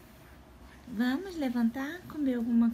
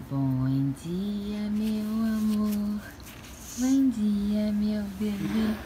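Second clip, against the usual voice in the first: tender wake-up; 5 semitones lower, much slower than usual. Real, not acted. Waking daughter tenderly.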